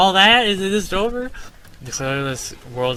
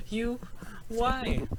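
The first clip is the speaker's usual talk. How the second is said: put-on higher pitched boy asking; a hint of a worried kid; lightly voiced child; 9 semitones higher, 8 dB quieter than usual.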